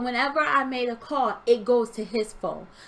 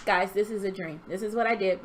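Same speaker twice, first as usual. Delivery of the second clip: ordinary delivery, nothing marked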